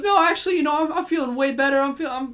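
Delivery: high, hurried cover-up voice; Jake Lockley. Retold or imitated. Character covering up his accent to slip away, falsely upbeat. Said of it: "he tried to cover up his accent".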